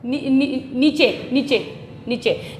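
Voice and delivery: "नीचे" softly tried out as nee-chay; hesitant soft false starts into "नीचे"; wrong reading. Shown as a demonstration. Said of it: "लेकिन ये नीचे नहीं होता".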